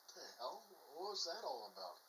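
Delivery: quiet, puzzled aside to yourself; confused. Acted. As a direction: mutter it softly in mock bafflement. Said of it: "looks kinda confused".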